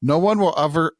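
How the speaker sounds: ordinary talk, nothing marked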